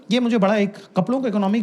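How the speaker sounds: ordinary delivery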